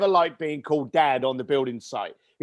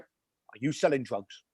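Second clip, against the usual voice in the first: hushed suspicion; 6 semitones lower, 7 dB quieter than usual. Retold or imitated. Mum leaning over, suspicious.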